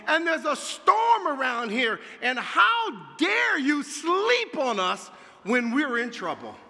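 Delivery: high, loud accusing plea; anguished shout. Acted. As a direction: hurl the complaint at the sleeping Jesus. Preacher voicing the disciples' complaint.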